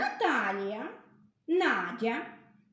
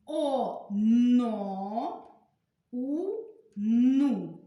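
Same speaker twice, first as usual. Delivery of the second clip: each syllable read alone, drawn out, with long pauses; slow syllable reading; much slower than usual. Shown as a demonstration. Teacher reading syllable columns aloud for young pupils, slowly. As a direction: model the reading one syllable at a time. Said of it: "Читаємо перший стовпчик".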